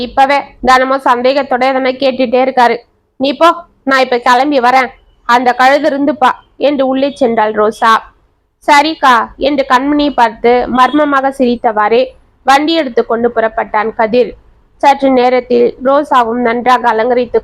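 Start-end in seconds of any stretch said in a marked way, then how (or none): none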